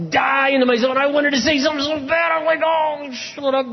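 high, strained outburst; straining self-restraint. Retold or imitated. Husband fighting the urge to answer his wife, straining. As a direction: voice pushed up as if barely holding back. Acts straining to hold words back.

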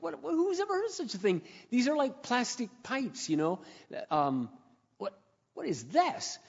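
incredulous homeowner staring at pipe; bewildered disbelief. Retold or imitated. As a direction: act baffled at the spraying pipe. Finding the leaking pipe.

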